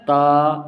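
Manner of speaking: تا said thin and light, held long; thin letter. Shown as a demonstration. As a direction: draw out a thin, unthickened تا. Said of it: "یہ باریک ہے".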